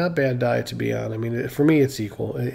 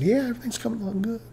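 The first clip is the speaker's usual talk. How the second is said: fake breezy reassurance; 9 semitones higher, 5 dB quieter, much faster than usual. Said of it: "I just kinda said".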